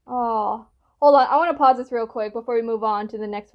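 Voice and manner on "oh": normal talking voice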